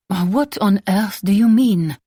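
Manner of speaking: a hint of a haughty French mother, slow; slow, lightly put-on mother's puzzlement; lightly voiced mother. A narrator's reading. Mother to daughter at tea, a touch of slow haughty disbelief.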